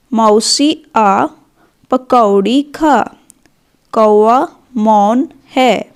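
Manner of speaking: slow, clear dictation of short sentences; slow read-aloud. Shown as a demonstration. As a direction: read each phrase slowly so children can follow. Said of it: "पढ़िए और समझिए". (बच्चों के लिए धीरे-धीरे वाक्य पढ़ना)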